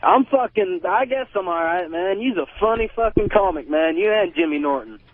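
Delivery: ordinary talk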